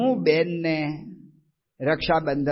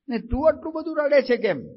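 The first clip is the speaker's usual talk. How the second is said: surprised question; 10 semitones higher than usual. Retold or imitated. Asks weeping woman why.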